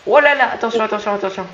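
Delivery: alarmed warning shout. Real, not acted. Warning teammate in danger.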